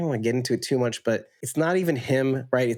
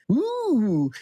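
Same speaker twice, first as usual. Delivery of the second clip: surprised; 11 semitones higher than usual. Real, not acted. Reacting while reading comment.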